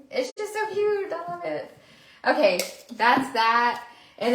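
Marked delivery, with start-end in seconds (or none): 0.1-1.8 high, cooing delight. Real, not acted. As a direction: gush over the cute little pie. Gushing over the cute pie.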